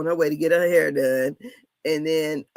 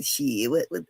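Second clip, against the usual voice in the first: much lower gruff-free mock of daddy; imitating her daddy; 6 semitones lower, 3 dB quieter, slower than usual. Daughter imitating her cussing daddy to listeners, lowered mock voice. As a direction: drop the voice low to play daddy. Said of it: "He be".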